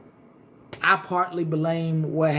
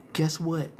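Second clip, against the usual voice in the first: usual speaking voice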